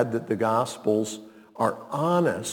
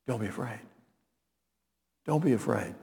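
reassuring Jesus; 4 semitones lower, 11 dB quieter, faster than usual. Acted. Jesus calms terrified disciples.

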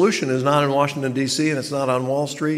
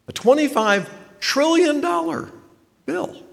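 amazed. Acted. Repeats the figure in amazement.

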